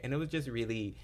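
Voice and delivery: ordinary talk, nothing marked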